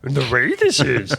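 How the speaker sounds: slow Yoda impression, high and loud. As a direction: do Yoda, drawn out and strained. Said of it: "That's a pretty good Yoda voice".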